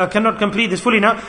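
ordinary delivery

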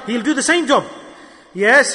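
ordinary talk, nothing marked

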